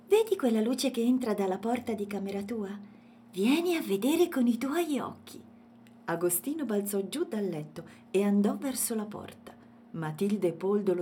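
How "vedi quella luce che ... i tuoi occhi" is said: high, louder character voice; eager little elephant. A narrator's reading. Elephant urges Matilde to come and see, eager. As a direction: urge them along eagerly.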